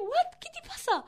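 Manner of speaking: chillido agudo y alarmado; amiga alarmada. Retold or imitated. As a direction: pregunta alarmada imitando a Lara, bien aguda. Imita a Lara preguntando. (imitando a una amiga alarmada)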